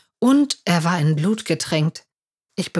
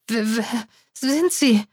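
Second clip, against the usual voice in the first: stammered, scared question rising much higher; trembling; 5 semitones higher than usual. A narrator's reading. Narrator facing an armed scarred stranger, trembling. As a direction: stutter in fear. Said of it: "fragte ich zittrig".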